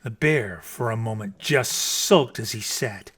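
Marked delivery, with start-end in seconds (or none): none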